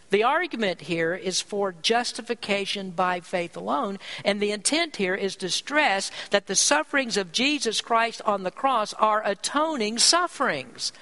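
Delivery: ordinary talk, nothing marked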